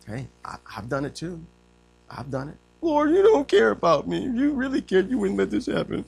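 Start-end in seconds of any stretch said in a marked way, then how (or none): 2.8-6.0 high, whiny poor-me complaint; mock self-pity. Preacher acting out his own prayer, mock self-pity. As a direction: whine to God, hammed-up. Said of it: "I'm feeling sorry for myself".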